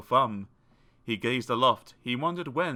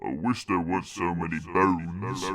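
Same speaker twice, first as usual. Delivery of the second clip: low, grumbling giant voice; a grumbling giant complaining; grumpy giant; 7 semitones lower than usual. A narrator's reading. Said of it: "in grumpy tones".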